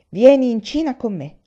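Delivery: urgent command. A narrator's reading. Brother urges him to return.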